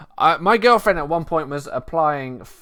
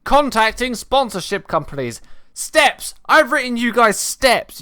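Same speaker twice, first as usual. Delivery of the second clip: excited announcing; 8 semitones higher, 9 dB louder, slower than usual. Real, not acted. Announcing the next section loudly.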